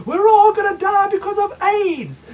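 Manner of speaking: high, loud panicky shout; hammed-up doom shout; mock panic. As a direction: wail it like the sky is falling. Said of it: "Then it was".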